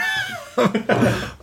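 high-pitched burst of laughter. Real, not acted. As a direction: laugh at your own joke. Speaker laughs after Penguin bit.

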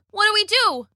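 high-pitched plea for a plan; acted helpless alarm; panicked. A narrator's reading. Trapped as T-Rex charges.

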